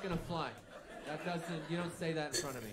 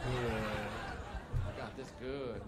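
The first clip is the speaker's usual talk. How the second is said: cocky boast; 6 dB louder, much slower than usual. Pretend. Confident boast, girlfriend squeezes leg.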